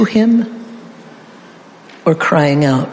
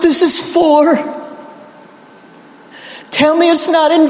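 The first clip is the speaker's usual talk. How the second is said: much higher, begging, near tears; Jesus pleading; 9 semitones higher than usual. Acted. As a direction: beg the Father for a reason, voice straining high. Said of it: "here's a little bit of my interpretation".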